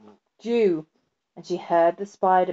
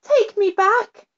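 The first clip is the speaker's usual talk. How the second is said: much higher, louder plea; the girl begs the fly-by-night, very high; girl crying out; 13 semitones higher, 5 dB louder, slower than usual. A narrator's reading. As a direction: beg like a scared child. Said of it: "cried the little girl".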